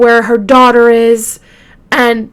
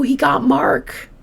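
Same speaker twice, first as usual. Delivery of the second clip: breathless whisper, voice dropped away; shocked hushed whisper; 6 dB quieter than usual. Real, not acted. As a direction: hush it as if it's too awful to say aloud. Gasping at the grim detail.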